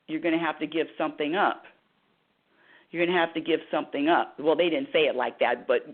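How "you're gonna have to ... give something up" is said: ordinary talk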